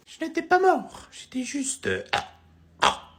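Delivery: very high, breathy falsetto pop star. Acted. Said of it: "je vous présente [name]".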